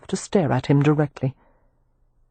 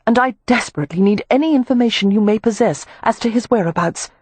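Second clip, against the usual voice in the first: put-on raised, polite young lady; Amelia's voice; 6 semitones higher than usual. Amelia addressing the club manager, formal and raised. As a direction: speak up, formally courteous.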